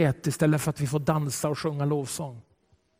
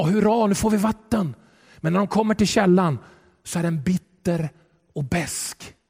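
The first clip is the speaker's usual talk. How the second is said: normal talking voice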